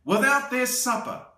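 lively picture-book read-aloud; storybook narration for a little one; reading to children. A narrator's reading. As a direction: read it like bedtime story time.

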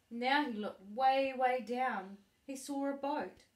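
ordinary delivery

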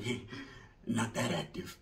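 low, soft put-on old-timer voice; old vato. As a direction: growl it low like a jaded veteran. Vato's reply.

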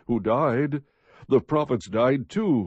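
ordinary delivery

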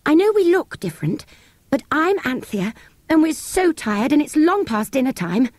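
put-on high, tired girl begging to be let in; pleading girl. A narrator's reading. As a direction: plead wearily at the closed door. Anthea pleads at the door.

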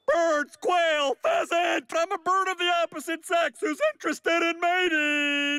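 high shrieked bird call in words. Acted. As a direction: squawk it out very high, like calling birds. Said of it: "with my patented bird calls".

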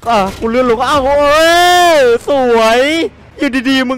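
high, loud frightened cries; panicked yelling. Real, not acted. Player panicking while a glitched creature chases him, yelling.

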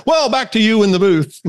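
high, hurried hand-back to the studio; high-pitched, abrupt TV sign-off; flustered field reporter. Acted. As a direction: cut away brightly in a high reporter voice. Reporter hastily hands back, acted.

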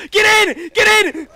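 high, loud yell to get in; urgent shout. Real, not acted. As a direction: yell it like the car is leaving now. Urging teammate into car.

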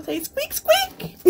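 high squeaking like a hamster; squeaky toy voice. Pretend. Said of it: "Which means bye in hamster".

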